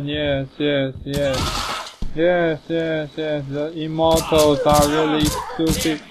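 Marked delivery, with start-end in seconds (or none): none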